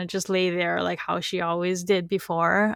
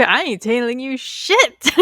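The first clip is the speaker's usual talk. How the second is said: louder, slowed-down mock defiance; defiant mock snarl; 4 semitones higher, 6 dB louder, slower than usual. A poisoned woman refusing her poisoner, mock defiant snarl. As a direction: spit it out like a stubborn prisoner. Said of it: "I'd be".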